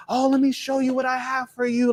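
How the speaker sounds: aunt greeting her nephew with delight; thrilled aunt. Retold or imitated. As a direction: gush over the boy like a doting aunt. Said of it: "she was just so thrilled to see you".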